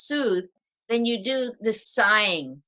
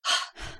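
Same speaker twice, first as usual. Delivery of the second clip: another quick sigh, softer; quick exhale; 4 dB quieter than usual. Shown as a demonstration. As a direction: show the relief sigh once more. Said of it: "Like that".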